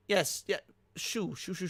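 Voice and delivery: ordinary delivery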